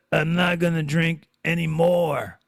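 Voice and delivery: a touch of a groggy vow; understated weary promise; mild hungover resolve. Retold or imitated. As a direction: voice the hungover self, lightly. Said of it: "did I say to myself".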